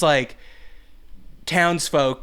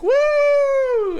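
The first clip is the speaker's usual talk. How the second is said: high, loud imitated woo; crowd whoop; 6 dB louder than usual. Someone in the crowd answers the announcer, high mock cheer. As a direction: whoop like a woman in the stands. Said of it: "from the crowd's like".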